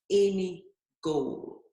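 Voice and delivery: normal talking voice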